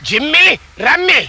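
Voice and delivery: high calling voice for the pets; calling dogs. Acted. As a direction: call out the dog names brightly.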